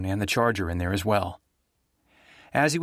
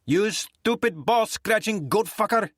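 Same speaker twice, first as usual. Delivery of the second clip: high, furious, slow insult; angry woman; 11 semitones higher, slower than usual. Acted. Rita cursing Nick as she picks up the call, furious and high. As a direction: spit every word of the insult at him. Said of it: "a woman's angry, rasping voice".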